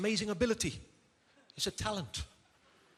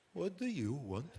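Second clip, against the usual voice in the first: softer, sneering question; disdainful; 8 dB quieter, slower than usual. Retold or imitated. Waiter turning on the husband with disdain, a quiet sneer. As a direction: look down on him as you ask. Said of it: "he turned to me with disdain".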